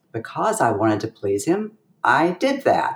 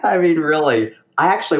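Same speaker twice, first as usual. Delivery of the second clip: laughing; 5 semitones higher, 3 dB louder, faster than usual. Real, not acted. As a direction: still laughing, a bit louder. Laughing recalling forgotten lesson.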